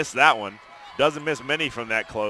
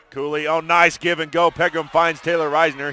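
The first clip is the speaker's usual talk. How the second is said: louder, higher live call; excited play-by-play; 5 semitones higher, 7 dB louder than usual. Real, not acted.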